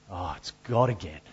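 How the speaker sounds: low, soft, weary shrug; a mock-bored groan at the caller ID; bored dismissal. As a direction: mutter it like an unwanted caller. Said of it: "and you'll go".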